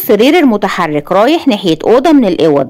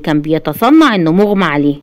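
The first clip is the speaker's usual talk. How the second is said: plain reading voice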